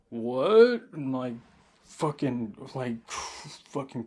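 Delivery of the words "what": loud, sharp startled what. Retold or imitated. Startled by the tall stranger, loud shocked what. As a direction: blurt it out, caught off guard. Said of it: "And I'm like".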